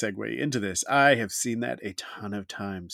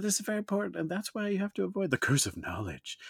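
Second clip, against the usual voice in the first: high, soft, earnest presenter voice; professional presenter voice; 8 semitones higher, 6 dB quieter than usual. Pretend. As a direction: play the serious keynote speaker. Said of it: "in professional [name] voice".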